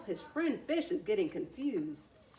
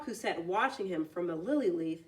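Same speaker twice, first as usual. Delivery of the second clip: plain reading voice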